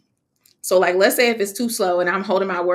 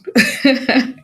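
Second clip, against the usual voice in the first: light laugh; 6 semitones higher, 7 dB louder than usual. Real, not acted. Laughing at own pun.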